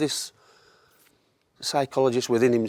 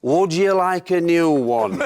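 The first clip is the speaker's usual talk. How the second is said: imitating the gaffer; 7 semitones higher, 5 dB louder, slower than usual.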